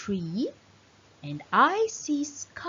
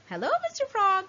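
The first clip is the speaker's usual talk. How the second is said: high, singsong hello to a frog; playful greeting; 10 semitones higher, much faster than usual. Acted. Teacher to children, greeting the frog picture in a high singsong.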